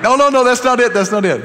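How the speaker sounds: high, loud mock protest from the crowd; mock protesting congregation. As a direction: play the audience objecting, hammed up. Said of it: "You were like".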